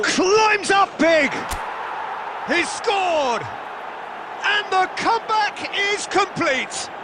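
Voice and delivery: slow, stretched high shout into the goal; excited goal call. Real, not acted. Commentator calling the completed comeback, slow high excited shout. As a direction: drag out the header, then erupt. Commentator calls the winning header.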